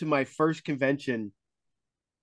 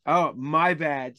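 ordinary delivery

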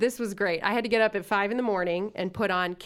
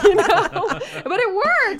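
ordinary delivery

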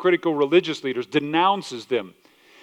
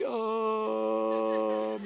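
awkward hesitation; 5 semitones lower, 7 dB quieter than usual. Retold or imitated. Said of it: "You're like".

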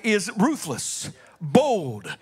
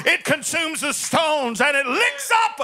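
raised, driving preacher's voice building to a shout; full-force preaching; 5 semitones higher than usual. Acted. Preacher hyping the Super Bowl of gods to his congregation, full-force preaching. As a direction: preach it out to the congregation like a showdown announcement. Climax of the fire story.

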